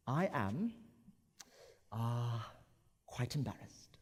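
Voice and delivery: halting, with an uh before quite; hesitant with filler. Shown as a demonstration. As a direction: pause and stumble, sound unsure. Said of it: "You see the difference?".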